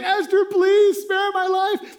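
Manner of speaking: high, loud, slow mock plea with a laugh; begging Haman. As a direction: ham up a desperate beg for mercy. Haman begs Esther.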